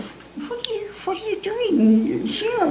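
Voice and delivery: high, soft, slow amazed voice; astonished question. Retold or imitated. Girl startled to find the pilot landed here, high and amazed. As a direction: gasp it out in wonder.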